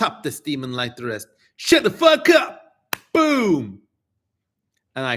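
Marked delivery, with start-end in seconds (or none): 1.6-2.6 high, loud yelled command; furious shout. Acted. Silencing the demon in reading. 3.1-3.8 loud, high exclamation; triumphant boom. Acted. Mock sound after silencing demon.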